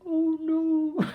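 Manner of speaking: high mock wail, half laughing; girl wailing. Girl behind her in the cinema, wailing in high mock dismay. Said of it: "this girl behind me, and she went".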